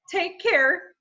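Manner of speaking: Calvin's voice. A narrator's reading. Mister Gorf uses Calvin's voice to say goodbye. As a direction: mimic the boy brightly. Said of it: "said Calvin's voice".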